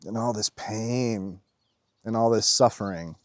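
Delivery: low, subdued, moved delivery; getting emotional. Real, not acted. Moved by others' suffering, quietly emotional. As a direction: hold back welling emotion, low and soft. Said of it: "[name], you're getting emotional".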